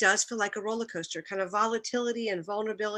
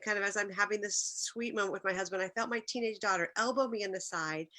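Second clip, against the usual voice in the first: normal talking voice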